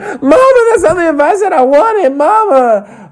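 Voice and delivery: very high mock-crying whine; Brittany protesting. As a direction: whine back at mama, hammed-up and tearful. Mimics Brittany whining at mother.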